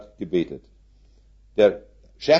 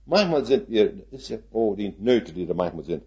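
ordinary talk

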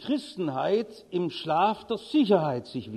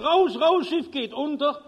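desperate calling out; 6 semitones higher than usual. Retold or imitated. Steward running past cabins warning passengers, desperate shout. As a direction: call out to the cabins in alarm. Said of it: "ruft verzweifelt".

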